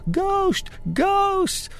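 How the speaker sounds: very high-pitched mock child's cry; imitating toddler's shouting. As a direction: squeal it like an excited two-year-old, without real volume. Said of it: "pointing in the corners and shouting".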